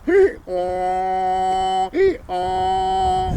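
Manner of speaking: hee-haw with a long held haw; awful donkey bray. A narrator's reading. Old donkey alone in a field, awful drawn-out braying. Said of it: "That old donkey sound plumb awful".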